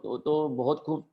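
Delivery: usual speaking voice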